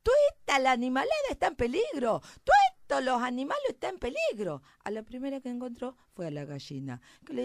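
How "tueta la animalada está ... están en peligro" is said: high, loud rustic little mouse; alarmed shouting. Acted. Little mouse warning all the farm animals, frantic shouting. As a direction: cry the alarm across the farm, first word shrieked high.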